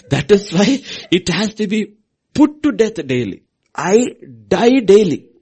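loud, high preacher's cry; loud, high preaching; fiery preaching. Acted. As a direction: thunder it from the pulpit. Preaching at full force.

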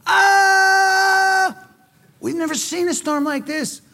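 high panicked shout of terror; panicked cry. Pretend. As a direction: scream it over the storm like sailors sure they'll sink. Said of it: "the guys on the deck are going".

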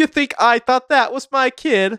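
indignant, very high and loud mock Cockney; Cockney accent. English Ezra objecting about the kid, Cockney accent. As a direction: protest in exaggerated Cockney. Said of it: "started doing a Cockney accent".

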